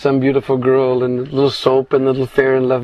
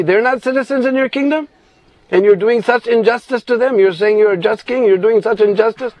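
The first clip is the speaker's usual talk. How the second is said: retold scolding; 8 semitones higher than usual. Grandfather blasting the king for his injustice, raised angry voice. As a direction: scold the king as his own father would. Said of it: "he starts blasting him like anything".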